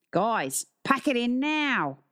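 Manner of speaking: high, drawn-out Aussie teacher's call; restrained shout in an Australian accent; mild shout. A narrator's reading. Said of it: "the teacher shouted".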